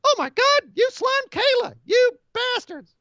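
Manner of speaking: very high, slow mock outrage; hammed-up shrieking fan. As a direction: wail it like a scandalised fan. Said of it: "He says".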